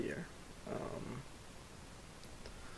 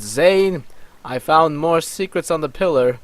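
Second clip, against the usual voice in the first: raised, higher calling voice; shouting; 5 semitones higher, 8 dB louder than usual. Acted. Myth calling to Zane about the pillar, a raised shout. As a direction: call out loudly to someone off elsewhere. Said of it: "Myth shouts".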